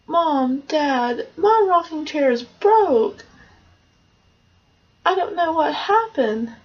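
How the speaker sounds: high, slow little bear voice; baby bear telling mom and dad his chair is broken. Acted. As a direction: lift the pitch and play the small cub.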